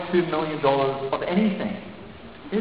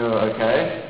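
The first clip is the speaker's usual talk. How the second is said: deadpan okay; 7 semitones lower, 6 dB quieter than usual. Retold or imitated. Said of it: "I go".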